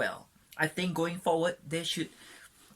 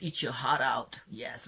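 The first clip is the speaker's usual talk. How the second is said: usual speaking voice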